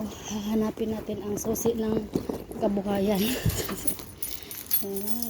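quiet late-night voice; hushed. Real, not acted. Late night at home entrance.

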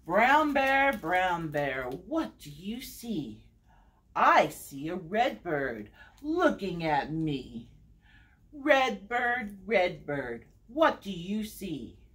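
sing-song read-aloud, each repeated name stepping down; story time refrain with falling line ends; picture-book reading. Acted. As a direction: read to little ones with lilting, measured phrasing. Reads picture book to children.

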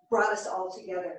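usual speaking voice

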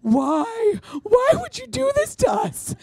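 very high, whiny mock wail. Mom and sister blame her for the sad story, mock wail. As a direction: ham up a tearful accusation. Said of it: "They're like".

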